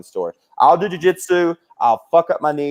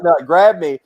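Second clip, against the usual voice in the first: ordinary delivery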